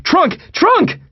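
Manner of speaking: very high urgent cry, rising on the repeat; yelled. A narrator's reading. Narrator spilling out of the car to John, yelled. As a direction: yell the one word twice, urgently. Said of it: "and I yelled".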